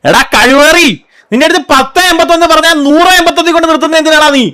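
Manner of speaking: loud, high-pitched telling-off; angry scolding. Acted. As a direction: yell at him for the address mix-up. Scolding driver over wrong address. (ദേഷ്യത്തോടെ ഉറക്കെ ശകാരിക്കുന്നു)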